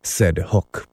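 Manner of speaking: ordinary delivery, nothing marked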